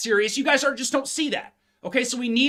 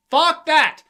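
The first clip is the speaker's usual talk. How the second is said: louder and higher, hard shout; forceful repeat; 5 semitones higher, 7 dB louder than usual. Real, not acted. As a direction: spit it out with force. Repeated rant line, forceful second take.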